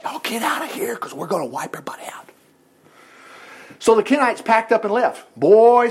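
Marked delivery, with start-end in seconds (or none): 0.0-2.3 whispered tip-off, soft and secretive; hushed warning. Acted. Army quietly warning neighbours to clear out, whispered.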